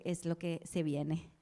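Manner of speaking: normal talking voice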